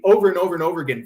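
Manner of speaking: ordinary talk, nothing marked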